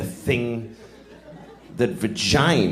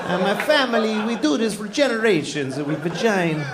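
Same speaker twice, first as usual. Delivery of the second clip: higher-pitched mock Italian craftsman; caricatured Italian accent, pitched up; 6 semitones higher than usual. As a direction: boast in a hammy Italian accent. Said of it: "nice old Italian table".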